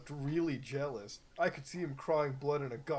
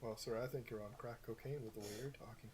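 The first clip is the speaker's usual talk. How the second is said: deadpan sass; 4 semitones lower, 12 dB quieter, faster than usual. Acted. Deadpan mocking reply to Dumbledore.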